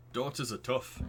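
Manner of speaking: put-on Northern English accent; lightly voiced Northern English dad; generic Northern accent. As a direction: speak as a Northern English neighbour. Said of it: "it's just a generic Northern accent".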